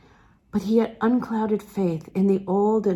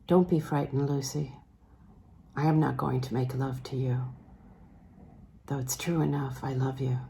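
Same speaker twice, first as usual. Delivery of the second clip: lower, softer man's voice, tender and careful; Sebastian's gentle voice; 6 semitones lower, 5 dB quieter than usual. Acted. Sebastian reassuring frightened Lucy he loves her, low and soft. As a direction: speak low and quiet as an older man calming a frightened girl.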